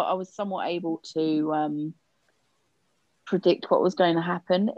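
normal talking voice

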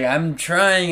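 imitating a berated player's protest; mock protest. Retold teammate protesting under yelling.

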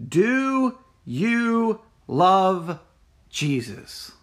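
slow searching question. Acted. Preacher asks searching question slowly.

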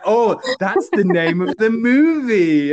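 loud, high, drawn-out exclamation; hammed-up amazement; mock realisation. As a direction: announce it slowly like a huge revelation. Mock reaction to title drop.

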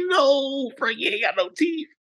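high, wailing protest; comic wail of disbelief; mock horror. Boyfriend protesting about Frankie to girlfriend, mock horror.

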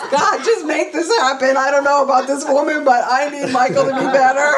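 high, loud hammed-up wail; mock sobbing. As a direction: plead through exaggerated crying, played for laughs. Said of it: "crying, sobbing".

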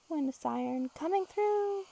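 usual speaking voice

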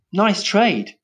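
high-pitched, casual approval; breezy approval. A narrator's reading. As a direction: toss off NICE TRADE lightly. Breezy remark, later scornfully echoed.

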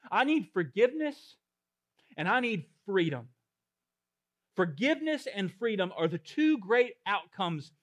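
loud, high and urgent pulpit delivery; full-force preaching. Real, not acted. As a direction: preach it out to the whole hall.

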